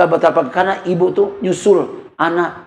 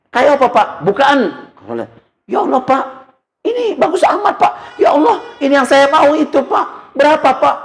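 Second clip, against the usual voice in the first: much higher, thrilled squeal; wife excited over a gift, high and gushing; delighted wife; 9 semitones higher than usual. Retold or imitated. Wife sees the new pillow.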